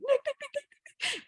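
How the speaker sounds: very high mock scream, held back in volume; high-pitched re-enacted calling; retold screaming. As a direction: squeal out the name in frantic repetition. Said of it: "I'm, like, screaming".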